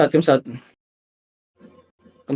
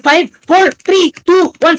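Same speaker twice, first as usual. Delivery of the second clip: posse leader shouting orders; 8 semitones higher, much slower than usual. Real, not acted. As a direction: yell the count to rally the team. Shotcaller counting down by the wall.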